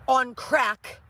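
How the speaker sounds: normal talking voice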